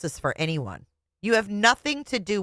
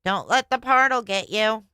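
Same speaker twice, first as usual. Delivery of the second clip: mock sign-off voice; 4 semitones higher than usual. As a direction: parody the host's farewell. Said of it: "That's funny, [name]".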